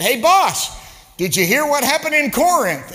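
put-on a breathless young preacher bringing gossip; young preacher telling the boss the news, excited; eager young preacher. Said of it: "one of these young preachers said".

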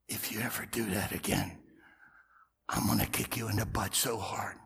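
low, hushed menacing whisper; whispered threat. Retold or imitated. Pastor leaning over the pew to threaten Alvin, whispered. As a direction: lean in and threaten under your breath.

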